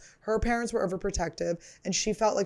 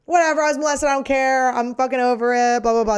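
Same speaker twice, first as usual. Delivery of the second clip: higher, louder, flippant brush-off; mock dismissive; 5 semitones higher, 7 dB louder than usual. Mocking how she brushes off her trauma, loud and flippant. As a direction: toss it off loud and careless, pretending not to care. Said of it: "going around going like".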